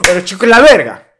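loud, tough comeback; explosive comeback. Retold or imitated. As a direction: snap back hard to scare him off. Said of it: "tienes que ser más explosivo".

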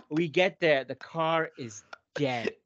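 ordinary talk, nothing marked

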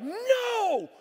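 high, loud, drawn-out no; panicked shout. Retold or imitated. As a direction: cry out in mock horror. Panicked dad as prank backfires.

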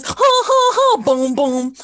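high, loud puppet fox laugh; [name] shows her taekwondo friends the Basil Brush fox, cackling high and loud; Basil Brush impression. Boom boom catchphrase, hammed up. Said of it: "the fox that goes".

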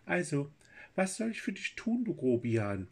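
high, squeaky little fairy; tiny piping voice, bossy. A narrator's reading. Fairy speaks to Kurt.

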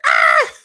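loud imitated yelp of disgust; mock scream. Predicting the mom's shriek at the picture, loud. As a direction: yelp like mom seeing the picture.

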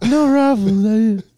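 very slow, high cartoon creep; weird character voice. Podcaster milking the chip joke, weird character voice. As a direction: drag it out high and silly.

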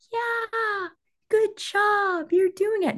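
high, sugary praise voice; mocking cheesy mentor. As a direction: mimic a fake-nice mentor cheering. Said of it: "the mentor that's like".

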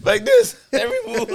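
high, laughing out the words; high-pitched giggle, barely getting words out; laughing hard. Real, not acted. Cracking up at friend's pose.

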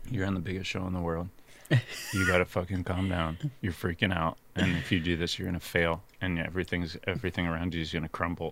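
a panicky private pep talk to herself; inner voice. Retold or imitated. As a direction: talk yourself down under your breath.

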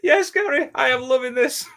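high, loud burst of glee; real delight. Real, not acted. Podcast host reacting to a viewer's comment, delighted. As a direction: exclaim it high and loud, tickled.

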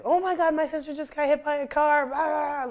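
high, loud panicked yell of her sister; retold yelling. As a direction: voice the sister yelling into the phone, frantic. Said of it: "like, yelling".

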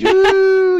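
loud high whoop; cheering woo. Real, not acted. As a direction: whoop like a crowd. Host cheering.

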